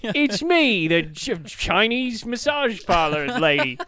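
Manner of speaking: slow, drawn-out mock Australian accent; hammed-up imitated accent, Australian-style; parlor lady's accent. Posing as the massage parlor lady, mock Australian accent. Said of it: "That was just her accent".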